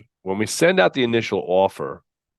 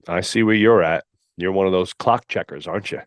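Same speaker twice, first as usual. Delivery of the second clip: ordinary talk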